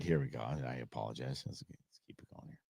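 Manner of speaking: barely voiced under-the-breath mumble; muttered aside. Real, not acted. Vlogger mutters an apology to viewers, whispered. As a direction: mutter it to yourself, almost whispering.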